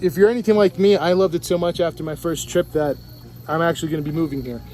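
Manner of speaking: ordinary talk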